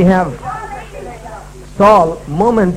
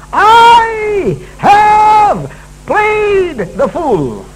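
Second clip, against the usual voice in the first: anguished confession; 11 semitones higher, 5 dB louder, much slower than usual. Acted. Saul's confession dramatized.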